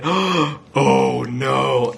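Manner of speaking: gasp, then a drawn-out cry of dismay. A narrator's reading. Onlookers see George heading for the dishes, dismayed gasp. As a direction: voice the onlookers' alarm.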